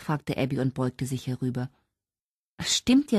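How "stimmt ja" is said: high-pitched cry of sudden remembering; exclaiming. A narrator's reading. Kira remembering Holly's birthday, exclaiming. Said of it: "rief Kira".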